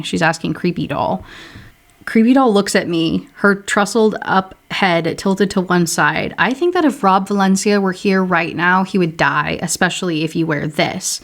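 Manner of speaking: ordinary delivery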